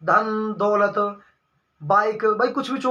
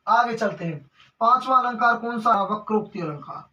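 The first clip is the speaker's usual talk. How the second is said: ordinary talk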